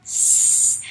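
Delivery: "S" as a hissing sss, no voice; teacher demos the S sound; letter sound s. Shown as a demonstration. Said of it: "The S no longer says".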